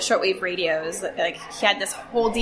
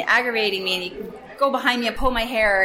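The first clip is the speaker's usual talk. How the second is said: ordinary talk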